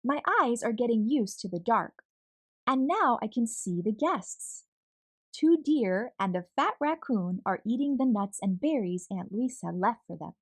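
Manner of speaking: usual speaking voice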